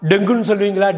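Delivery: ordinary talk, nothing marked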